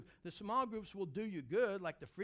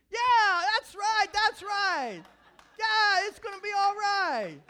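high, loud exultant yes; loud, high rejoicing; joyful shout; 12 semitones higher, 15 dB louder, slower than usual. Retold or imitated. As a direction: shout it out to the congregation. Retold shouting agreement on phone.

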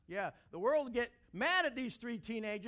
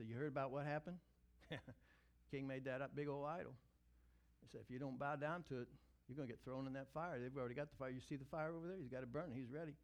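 low, soft worried murmur among friends; hushed bunk-room talk; 7 semitones lower, 8 dB quieter, faster than usual. Retold or imitated. Friends huddled at night, hushed. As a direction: keep it down so no one hears.

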